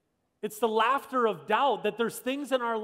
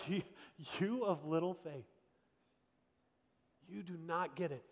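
soft, lowered, understated reproof; quiet mock disbelief; 4 semitones lower, 10 dB quieter than usual. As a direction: drop low and soft, gently incredulous. Jesus answering freaked-out disciples.

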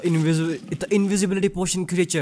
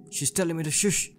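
normal talking voice

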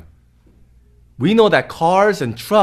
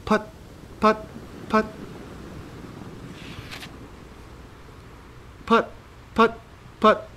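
puttering motor; 7 semitones higher, 10 dB quieter than usual. Acted. Boat motor sound effect.

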